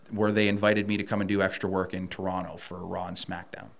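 normal talking voice

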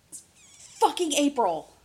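punched-out, exasperated complaint; exasperated cursing. Real, not acted. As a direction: curse the month indignantly. Outraged at mosquito in April.

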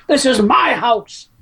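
slow, drawn-out angry father line; mad dad line. Retold or imitated. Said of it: "he says".

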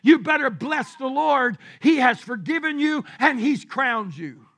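loud, emphatic pulpit proclamation; full-force preaching. Real, not acted. Preacher urging the congregation to bless the Lord, full force.

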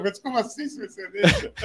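high incredulous laughing question; surprised. Retold or imitated. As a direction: ask it in amused disbelief. Retelling shocked reaction at council.

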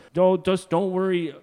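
put-on drawn out like a cocky old man; breezy old man. Al brushing him off again.

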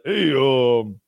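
lightly voiced action-hero impression; a hint of a slurred movie tough guy; Rambo. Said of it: "if Rambo was actually there".

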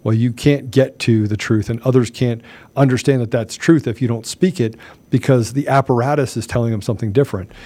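ordinary talk

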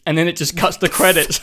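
usual speaking voice

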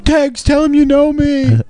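high, drawn-out mock wail; mock crying. Guest imagines begging mate for help mid-beating, mock crying. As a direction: pretend to cry out a plea, stretched and high. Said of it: "me crying, saying".

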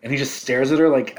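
usual speaking voice

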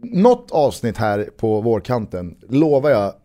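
ordinary delivery

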